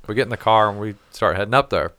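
usual speaking voice

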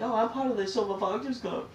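high-pitched mock pride; mocking imitation. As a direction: mimic a silly, proud club member. Mimics people embracing the label.

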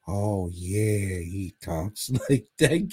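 lightly voiced lispy old man; mock a lispy old man; creepy old guy. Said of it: "old fucking guy who talks like".

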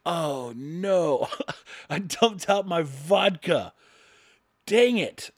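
drawn-out comic lament with a laugh; mock dismay. Husband opening empty liquor cabinet, hammed-up dismay. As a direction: wail slowly in pretend regret.

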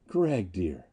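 soft, low endearment; tender. A narrator's reading. Anita in Gregg's arms, tender. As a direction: murmur it lovingly. Lovers embracing.

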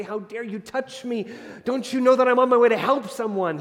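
a hint of an affronted teacher snapping back; building from a mild rebuke to a loud indignant question; mock indignant rebuke. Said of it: "Jesus doesn't turn around and say".

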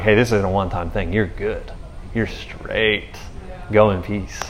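calm her fears gently; reassuring. Retold or imitated.

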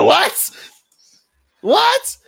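very high loud shocked what; shocked squeak. Retold or imitated. Boy shocked at her question, high squeak. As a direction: yelp in disbelief.

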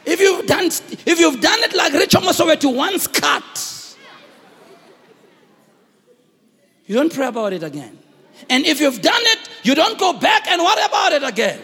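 high, loud sung preaching; preaching at full force. Acted. Pastor to believers about worry, loud sung preaching. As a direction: lift it into a sung cry.

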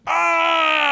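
high piercing shriek imitating a little boy; kid's shriek. Imitating a boy screaming at nothing. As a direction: shriek like a screaming five-year-old. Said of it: "he's just going".

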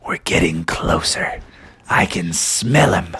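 low whispery troll voice; hushed, low troll; troll on the scent. Acted. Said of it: "he's like".

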